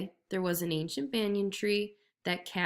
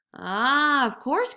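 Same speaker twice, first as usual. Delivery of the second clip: raised, eager jackal; mock realisation; 5 semitones higher, 5 dB louder, much slower than usual. A narrator's reading. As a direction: pretend it finally clicks. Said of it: "cried the jackal".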